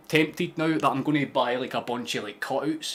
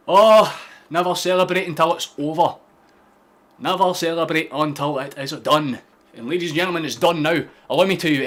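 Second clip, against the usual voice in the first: raised, triumphant voice; loud crowing; 5 semitones higher, 8 dB louder than usual. Real, not acted. Streamer crowing over a late winner, loud and triumphant. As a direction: crow it loudly to the losers.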